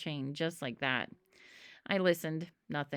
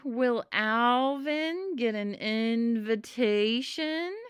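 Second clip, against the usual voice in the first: slow, louder, slurred through drool; drooling heavily; 4 semitones higher, 5 dB louder, much slower than usual. Acted. Sam asking the mammoth eye, drooling heavily. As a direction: speak with a mouthful of spit. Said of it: "drooling heavily".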